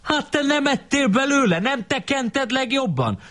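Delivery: very high, squabbling schoolboy voice; hammed-up indignant counter-accusation; acted angry retort. A narrator's reading. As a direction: throw the blame back in a high voice. Acted quarrel between the boys.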